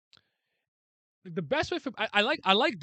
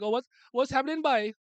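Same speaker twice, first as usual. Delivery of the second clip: higher, sing-song Trinidadian accent impression; light Trinidadian accent, pitched up; 8 semitones higher than usual. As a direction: mimic a Trini relative greeting in Trinidadian accent. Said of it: "Trini's like".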